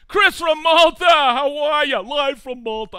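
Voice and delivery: loud, high greeting yelled out; excited shout-out. Real, not acted. Host yells a happy greeting to Chris from Malta, loud and high. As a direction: call out like spotting a friend.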